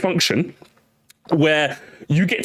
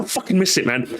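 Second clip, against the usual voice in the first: usual speaking voice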